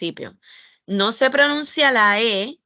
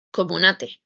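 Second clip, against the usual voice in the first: normal talking voice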